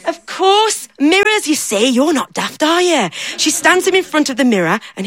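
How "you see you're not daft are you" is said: plain reading voice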